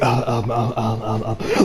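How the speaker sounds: low, soft string of stammered ums; lost for words. Retold or imitated. Akira put on the spot by his pushy friend, lost for words. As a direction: imitate someone tongue-tied, quietly. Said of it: "is lost for words".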